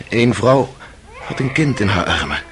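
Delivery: usual speaking voice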